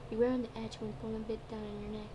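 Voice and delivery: ordinary delivery, nothing marked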